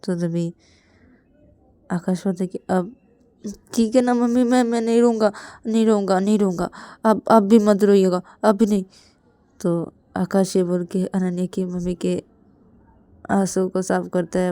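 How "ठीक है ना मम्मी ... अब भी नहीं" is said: put-on loud, earnest reassurance from a child; pleading, emphatic boy; insistent promise. Child promises, consoles crying mother.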